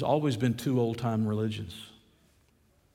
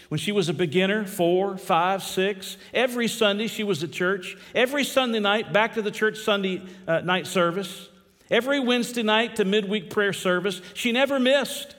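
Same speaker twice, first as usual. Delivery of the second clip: climbing higher and louder with each Sunday; building sermon cadence; 7 semitones higher, 4 dB louder than usual. Acted. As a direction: preach it rising, listing every Sunday. Preaching at full force building.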